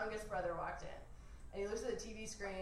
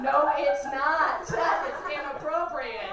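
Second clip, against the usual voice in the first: loud, drawn-out indignant correction; raised, slow, offended protest; mock scolding; 5 semitones higher, 12 dB louder, slower than usual. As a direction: scold a brother in hammed-up outrage. Acted scolding of stoned brother.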